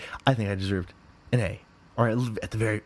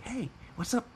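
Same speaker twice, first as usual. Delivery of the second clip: higher and softer, breezy and casual; sheepish casual email greeting; mock casual greeting; 13 semitones higher, 7 dB quieter than usual. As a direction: toss off a casual hey to the teacher. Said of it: "several weeks ago saying".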